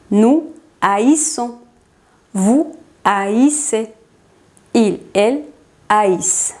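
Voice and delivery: slow "haïssons", "haïssez", "haïssent" with A and I as two vowels; A and I separated. Shown as a demonstration. Teacher showing the other three forms, A-I split in "haïssons", "haïssez", "haïssent". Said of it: "separamos la A de la I y se pronuncia A".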